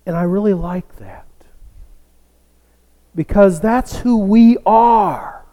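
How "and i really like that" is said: whispered aside. Real, not acted. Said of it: "whispered aside".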